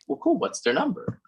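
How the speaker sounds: chirpy mock friend asking casually; mock high friend voice. Friends voiced in retold story.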